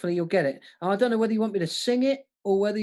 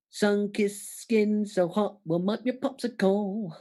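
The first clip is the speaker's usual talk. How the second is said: slow, deliberate lyric reading; measured recital of the lyric; slow careful read; slower than usual. Shown as a demonstration. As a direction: read the line out slowly and evenly. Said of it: "Like that".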